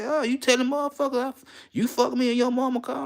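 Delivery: high, huffy imitation of the angry girlfriend; mock girlfriend.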